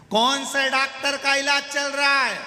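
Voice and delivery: high, loud, sustained holler; shouting. Acted. Deaf visitor questioning the patient, shouting. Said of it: "वो चिल्ला के बोलता है".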